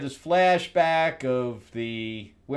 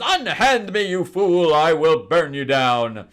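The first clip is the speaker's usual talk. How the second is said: higher, louder hammy proclamation; melodramatic heroine; 5 semitones higher, 8 dB louder, slower than usual. Retold or imitated. Firestar threatening Nimrod, loud and theatrically grand. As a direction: declaim it like a stage heroine. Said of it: "and she says".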